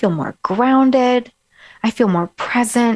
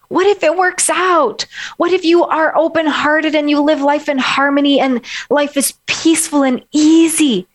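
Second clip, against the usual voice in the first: higher, smiling, buoyant questions; smiling energetic; 6 semitones higher than usual. Shown as a demonstration. Coach contrasting an uplifted mood to clients. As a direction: lift the voice like shoulders opening up. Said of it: "the smile and the energy in my voice".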